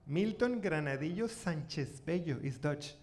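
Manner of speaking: normal talking voice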